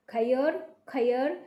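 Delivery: खैर drawn out as kh-ayar, slowly; slow ai demo. Shown as a demonstration. Teacher slowly models खैर for officers, drawn out. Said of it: "थोड़ा सा ये ऐसा दिखाई देगा".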